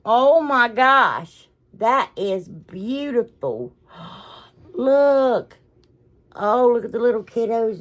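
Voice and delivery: high, slow, drawn-out gush with a gasp; gushing delight. Real, not acted. Showing viewers a beautiful item, gushing in delight. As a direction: swoon loudly over it, stretching the words.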